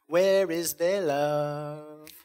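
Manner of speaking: put-on slow, drawn-out joking Mexican accent; jolly Mexican accent. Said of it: "big, jolly Mexican accent".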